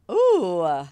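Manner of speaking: loud, far-off thrilled ooh; excited ooh. Real, not acted. Reading the card to her co-host, thrilled ooh. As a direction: let the thrill burst out.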